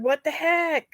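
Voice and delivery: ordinary delivery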